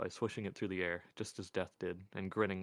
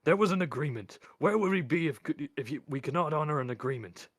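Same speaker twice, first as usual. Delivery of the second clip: loud, high, indignant outburst; agitated appeal at raised pitch; desperate protest; 7 semitones higher, 8 dB louder than usual. A narrator's reading. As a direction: shout your case, stumbling over the words. Albert protests more desperately.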